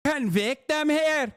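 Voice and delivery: high mock whining voice; mocking impression. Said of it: "Look at my regional accent".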